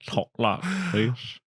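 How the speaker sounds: slow, mock Texas drawl. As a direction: stretch it out like a Texan. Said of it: "Talks like he's from Texas now".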